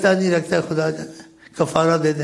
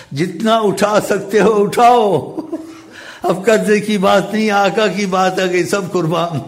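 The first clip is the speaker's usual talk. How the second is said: exultant generous offer; 5 semitones higher, 4 dB louder than usual. Retold or imitated. Host flings open his rooms for the Prophet's messenger, exultant. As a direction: call it out high and expansive, giving everything away.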